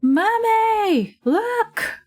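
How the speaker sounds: put-on high excited little kid voice; child calling out. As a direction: squeal it like a small child. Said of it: "They're like".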